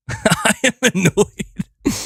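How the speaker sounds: laughing through the words; slow, high and laughing. Real, not acted. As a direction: say it laughing at yourself.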